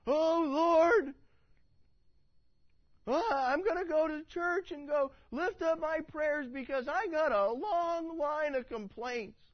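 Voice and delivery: high, loud mock whine of a churchgoer; whiny complainer. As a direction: ham up a griping worshipper. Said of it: "to whine and to complain".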